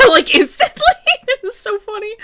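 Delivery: high laughing voice. Real, not acted. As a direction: laugh through the words. Laughing loudly.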